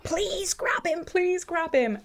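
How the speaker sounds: high, slow pleading to the couple; high, slow retold plea. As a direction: beg them to grab him. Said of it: "so I'm like".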